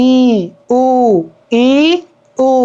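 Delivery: ই and উ as separate held "ee", "oo"; ই then উ, each sustained alone; closed vowel demo. Shown as a demonstration. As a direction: say ই, উ with lips barely open. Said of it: "সবচেয়ে কম খোলা থাকে".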